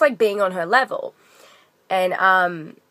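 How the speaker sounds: ordinary talk, nothing marked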